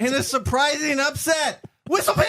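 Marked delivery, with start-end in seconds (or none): 1.9-2.2 big rising reveal, much higher; excited announcement. Real, not acted. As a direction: announce the upset winner with a flourish. Announcing the surprise winner.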